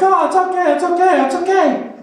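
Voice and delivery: high-pitched, repeated nervous reassurance; awkward reassurance. Retold or imitated. Comic retelling to friends laughing at him, high awkward reassurance. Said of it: "awkwardly looking at the people with me".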